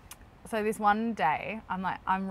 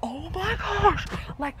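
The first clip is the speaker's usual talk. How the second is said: panicked whisper; 6 semitones higher than usual. Retold or imitated. Retold panic at kangaroo.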